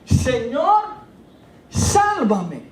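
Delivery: high, loud, slow impassioned preaching; full-force preaching. Acted. Pastor preaching to church on Peter's faith, full force. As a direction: pour it out over the congregation.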